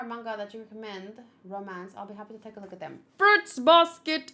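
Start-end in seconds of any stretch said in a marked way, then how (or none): 3.2-4.3 loud and slow, selling the title; excited recommendation. Real, not acted. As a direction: belt out the title like a hot tip. Eager anime recommendation.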